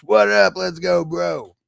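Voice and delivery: loud pumped-up bro greeting; gym bro. Acted. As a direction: hype up the viewer like a gym buddy. Greeting chat viewer, hyped.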